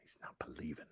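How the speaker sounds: whisper. Retold or imitated. Mocking echo of the clip.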